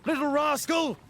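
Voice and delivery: put-on high, loud shout at a naughty boy; sudden scolding shout. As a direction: snap it out like a cross priest catching a rascal. Disguised priest scolds a boy.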